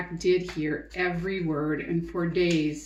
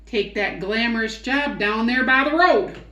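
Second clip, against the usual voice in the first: put-on old hound voice; 4 semitones higher, 6 dB louder than usual.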